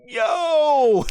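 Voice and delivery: loud, high "yo" of disbelief; amazed outburst. Retold or imitated. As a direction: shout it out, blown away by the spot. Acts out stunned reaction to spot.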